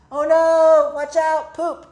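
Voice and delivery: high, loud mock panic; playful alarm. Mole dodging the poop, hammed-up alarm. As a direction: yelp a warning, hammed-up.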